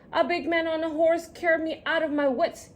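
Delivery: ordinary delivery, nothing marked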